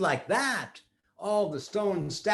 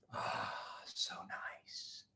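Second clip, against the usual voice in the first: whisper; 18 dB quieter, much slower than usual. Acted. Savouring the perfume.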